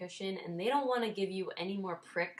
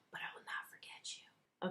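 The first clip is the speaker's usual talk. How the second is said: mock-ominous whisper; menacing whisper; 11 dB quieter than usual. Patient to the hospital that erred, whispered warning. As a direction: whisper it like a playful grudge.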